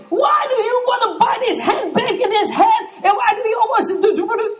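high, loud mock rant with laughter; hammed-up outburst about a handbag; exploding rant. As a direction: blow up at her over the handbag, half laughing. Exploding at wife over handbag.

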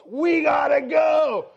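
high, drawn-out mock shout; urgent, stretched-out holler; panicked yell. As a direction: imitate a midwife yelling in panic. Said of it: "got a panic in her eyes".

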